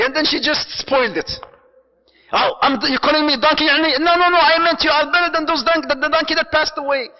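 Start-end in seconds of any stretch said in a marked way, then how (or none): 2.3-7.1 higher and louder, hammed-up offended back-and-forth; mock indignant exchange. Husband takes offence at the donkey compliment, hammed-up and louder. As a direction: play both spouses' flustered squabble.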